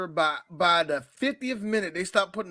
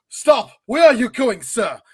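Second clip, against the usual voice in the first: a louder, sharp stop call; stern guard's call; 3 semitones higher, 7 dB louder than usual. Retold or imitated. Movie guard halting Cage, loud mock call. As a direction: bark the order like a guard. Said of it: "he like".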